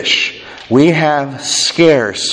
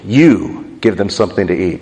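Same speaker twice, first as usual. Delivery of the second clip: "You" stressed and set apart, the rest low; low-pitched line led by a stressed "You"; emphatic YOU; 8 semitones lower than usual. Shown as a demonstration. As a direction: punch "You", then drop down for the rest. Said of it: "is emphatic in the Greek".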